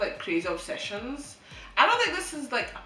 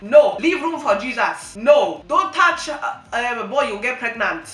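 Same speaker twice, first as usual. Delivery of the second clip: strict parents; 9 dB louder than usual. Retold or imitated. Imitating parents warning their daughter about boys, loud. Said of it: "your parents are like".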